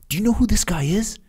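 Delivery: high, urgent warning to the crowd; excited call-out. Retold or imitated. Teammate shouting to football players.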